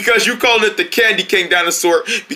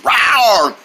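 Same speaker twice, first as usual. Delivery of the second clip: repeated falling rawr; pretend dinosaur roar. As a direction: roar playfully like a toy dinosaur. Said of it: "He's pretending to be a dinosaur".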